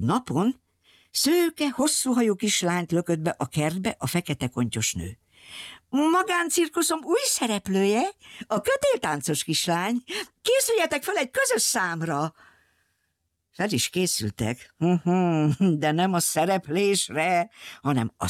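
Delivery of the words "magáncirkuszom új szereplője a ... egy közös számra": lightly voiced captor woman. Captor woman presents new performer.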